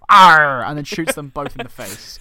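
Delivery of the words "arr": pirate arr. Retold or imitated. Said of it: "he goes".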